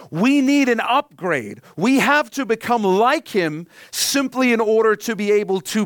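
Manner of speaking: fervent pulpit delivery; impassioned preaching. Acted. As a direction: press the point home to the congregation. Building sermon point.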